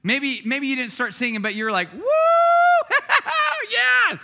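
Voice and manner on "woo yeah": high, loud whoop of joy; celebratory screaming. Acted. Acting someone celebrating an amazing moment, loud excited yell. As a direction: whoop and yell like you've just won. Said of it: "You started screaming, you started yelling".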